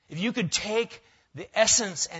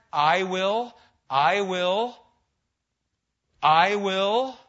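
"I will" loud and higher, building each time; emphatic repetition; 3 semitones higher, 8 dB louder, much slower than usual. Shown as a demonstration. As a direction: hammer out each "I will", the last one loudest. Preacher stresses repeated words of text.

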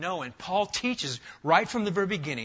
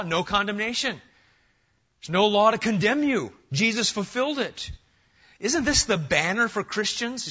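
louder, building pulpit cadence; full-force preaching; 4 semitones higher, 4 dB louder than usual. Acted. Pastor rousing his church on assurance, fervent preaching. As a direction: preach it out to the back row.

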